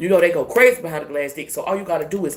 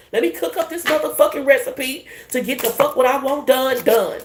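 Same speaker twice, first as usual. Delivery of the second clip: hyped boast; 7 semitones higher than usual. Real, not acted. Hyped boast about her recipe.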